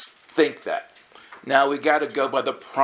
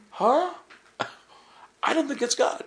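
doubtful huh; 14 semitones higher than usual. Retold or imitated. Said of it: "you went like".

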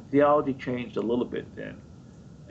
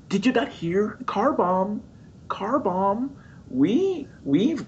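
mock alarm; 8 semitones higher, slower than usual. Panicked inner thought, car bomb.

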